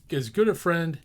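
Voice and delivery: ordinary delivery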